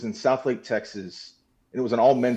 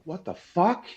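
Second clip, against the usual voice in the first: higher-pitched, baffled retort; retold disbelief; 5 semitones higher, slower than usual. Man retelling his shock at being cut off in a meeting, baffled. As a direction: act out being taken aback.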